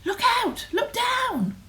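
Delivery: high urgent yell; high two-part storybook alarm cry; warning yell. A narrator's reading. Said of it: "who yelled".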